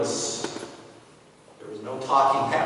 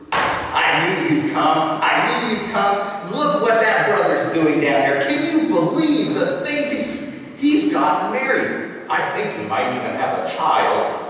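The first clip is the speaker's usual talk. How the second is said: loud, eager gossiping voice; judgmental brother; 5 semitones higher, 6 dB louder than usual. Acted. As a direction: gloat over the other's faults. Judging brother on the hillside.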